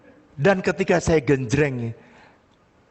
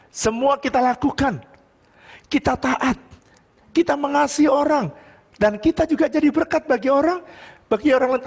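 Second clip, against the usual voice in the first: raised, high-pitched sermon voice; impassioned preaching; 13 semitones higher than usual. Real, not acted. Pastor urges congregation toward a different way of life, impassioned preaching. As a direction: drive the message home to the congregation.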